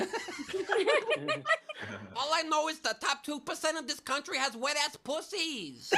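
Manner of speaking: high-pitched political parody voice. Acted. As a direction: rant it in a high, put-on voice. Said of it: "sounds like if the B stands for [name]".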